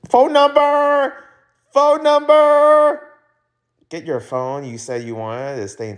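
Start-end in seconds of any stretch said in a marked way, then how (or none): 0.1-3.0 much higher, slow eager call; excited. Acted. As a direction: remember suddenly and call it out. Suddenly remembering number.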